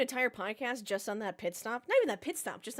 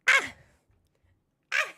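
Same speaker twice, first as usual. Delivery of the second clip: frustrated yell; 10 dB louder than usual. Real, not acted. Frustrated at the race result.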